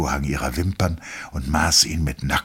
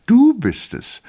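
very high, loud woman's voice; wife greets her husband in a very high voice; wife's voice; 7 dB louder, slower than usual. A narrator's reading.

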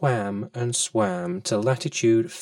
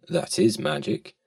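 plain reading voice

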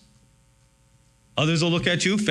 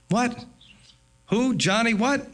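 mock surprise; 6 semitones higher than usual. Father feigns surprise at accuser.

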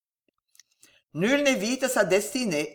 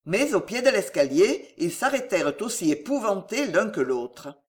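ordinary delivery

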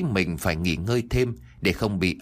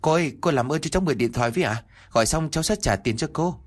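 fast, higher begging; urgent repeated plea; 5 semitones higher, faster than usual. Acted. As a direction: plead again, hurrying. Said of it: "Tôi liền lặp lại nói". (lặp lại lời xin, nhanh và cao giọng)

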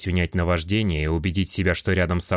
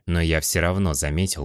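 plain reading voice

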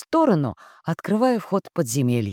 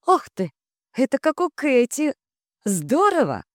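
put-on high, thrilled young witch; delighted; 9 semitones higher than usual. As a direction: squeal it out in delight. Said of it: "восторженно оценила Хэти". (восторженно)

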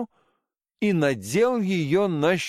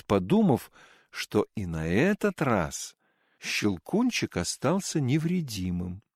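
plain reading voice

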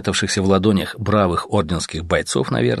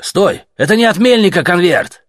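higher and louder, calling him back; shout; 10 semitones higher, 6 dB louder than usual. Acted. Soldier shouting after the leaving officer. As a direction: yell after the departing man.

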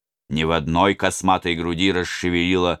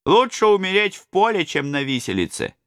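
grim robber; 13 semitones higher than usual. Acted. Robber to the crowd, high, resolute voice. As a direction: say it like a man who has made up his mind.

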